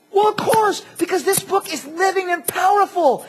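high, emphatic exclamation; excited realization. Acted. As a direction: proclaim it like it just dawned on you. Acted excited realization while preaching.

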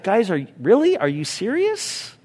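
a hammed-up "are you serious" at the disciples; exasperated Jesus. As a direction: playfully voice a fed-up teacher. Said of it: "He's like".

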